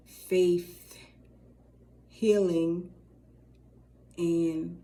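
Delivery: drawn-out careful listing that gets the names mixed up; slow, spaced out. Shown as a demonstration. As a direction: read the names slowly one by one, stumbling. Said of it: "I have to say it fast, y'all, because if I say it slow".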